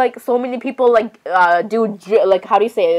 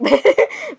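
short, high-pitched giggle; quick high laugh; 11 semitones higher than usual. Real, not acted. Player amused mid-apology, short high giggle. Amused aside while playing.

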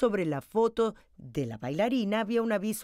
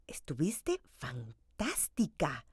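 soft, slow, hushed praise; a quiet whispered compliment; 2 semitones lower, 5 dB quieter, slower than usual. Acted. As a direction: breathe it to her like a secret. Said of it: "le susurró Natalie".

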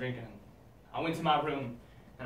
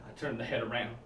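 ordinary talk, nothing marked

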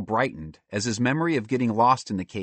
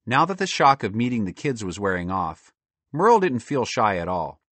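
usual speaking voice

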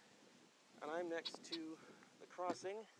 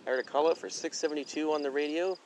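raised voice against loud wind; shouting over wind; 6 dB louder than usual. Real, not acted. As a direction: call out louder over the wind. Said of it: "Hopefully you can hear me over the wind".

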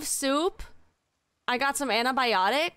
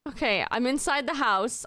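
ordinary delivery

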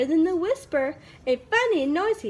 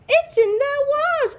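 screech; 11 semitones higher, 4 dB louder than usual. Acted. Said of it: "Owl screeched".

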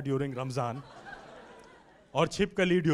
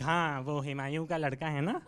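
louder relative, fast and chatty; gossiping relative voice; 5 dB louder, much faster than usual. Retold or imitated. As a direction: brag like a clueless relative. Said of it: "They're like".

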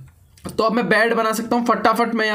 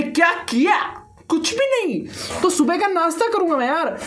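laughing through the words, much higher; 7 semitones higher than usual. Real, not acted. Gamer joking to viewers about breakfast, laughing. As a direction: tease the viewers with a giggle.

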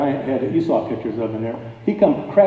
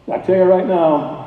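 normal talking voice

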